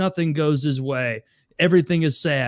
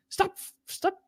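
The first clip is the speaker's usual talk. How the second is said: high, thin, quieter mock scold of a mother; stern mom; 6 dB quieter than usual. Friend imitating the mom on the bus, high mock scold. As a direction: shoo a stranger away from your child.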